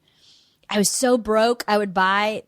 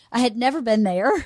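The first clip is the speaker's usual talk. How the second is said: ordinary talk, nothing marked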